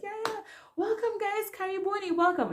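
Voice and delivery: high, sing-song cheery greeting; breezy welcome. Retold or imitated. Greeting arriving colleagues, covering up with cheer. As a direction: act as if nothing happened.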